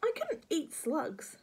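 put-on slow, high child protest; horrified boy. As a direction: draw it out in disgust. George horrified at eating slugs.

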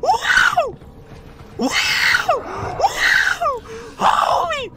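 high-pitched shrieks; screaming in panic; panicking gamer. Real, not acted. Screaming during chase.

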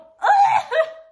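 very high, loud squeal of laughter. Real, not acted. Fan overwhelmed by the letter, squealing laugh. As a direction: squeal with delight.

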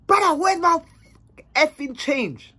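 high, heated creditor's demand; mock outraged confrontation; acted irate demand. Acted caller repeating angry demand.

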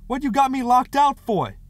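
very high, loud complaint; indignant shouted question; holler. A narrator's reading. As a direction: holler in protest at being locked out. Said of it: "he hollered".

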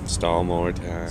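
slow resigned echo under the breath; weary echo. Real, not acted. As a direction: mutter it back, resigned. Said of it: "So now, stall more time".

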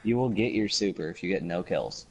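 normal talking voice

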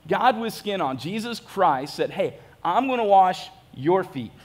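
usual speaking voice

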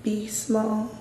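quiet guiding voice inside the head; inner voice. Retold or imitated. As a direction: hush it like a voice in your head. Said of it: "I heard a voice in my head".

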